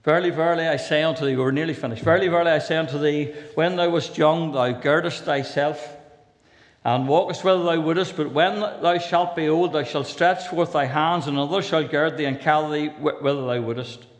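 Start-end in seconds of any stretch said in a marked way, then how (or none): 0.0-1.3 scripture reading. Acted. Reads King James scripture aloud. 2.0-14.1 raised, measured scripture reading voice; King James reading. Acted. Preacher reading John's gospel to the congregation, solemn.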